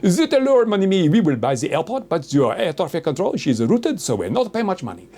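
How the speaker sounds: a hammed-up French buyer with a heavy French accent. French buyers haggling over the airport in a mock French accent. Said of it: "French people were gonna come along and go".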